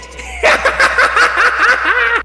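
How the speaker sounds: high, loud mocking cackle; villain cackle. Green Goblin cackling over his captive. Villain laughs after line.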